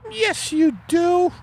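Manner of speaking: very high falsetto wife voice; a high put-on wife, puzzled; Margaret voice. Acted couple skit, wife voice.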